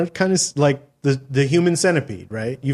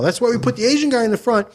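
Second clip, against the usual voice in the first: higher, emphatic director pitch; Tom Six; 5 semitones higher than usual. Acted. Director explaining the plot to actors, eager pitch. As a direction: lay out the mad plan proudly. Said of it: "now imagine Tom Six, that's the director".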